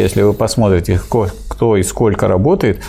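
usual speaking voice

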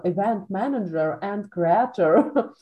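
put-on slow Russian-tinged English accent. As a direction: say it like an English speaker, slowly. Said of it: "event manager сказала с английским акцентом".

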